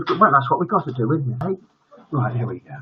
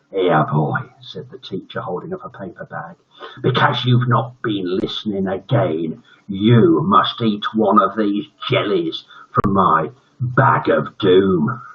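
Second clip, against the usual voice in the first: menacing teacher; 3 semitones lower than usual. Acted. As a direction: savour the words bag of doom. Villain teacher offers doom jellies.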